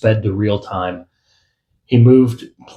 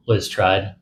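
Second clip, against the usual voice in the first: plain reading voice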